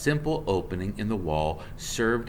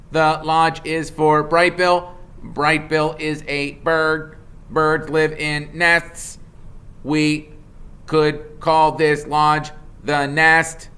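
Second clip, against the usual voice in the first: slow, level machine logic, higher and louder; robot reasoning; 8 semitones higher, 7 dB louder, slower than usual. Acted. Roz reasons aloud to Mister Beaver in a slow, level robot voice. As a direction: work it out step by step like a computer.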